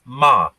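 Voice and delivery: "ma" as one low syllable, falling; Chinese tone demo. Shown as a demonstration. Teacher introducing a Chinese syllable, "ma" said low and falling. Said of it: "it's the tone that you change".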